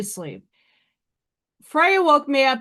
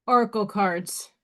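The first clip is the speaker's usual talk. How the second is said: usual speaking voice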